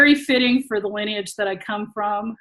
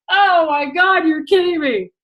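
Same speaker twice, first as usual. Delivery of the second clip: amused disbelief; 7 semitones higher, 4 dB louder than usual. Retold or imitated. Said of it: "I was like".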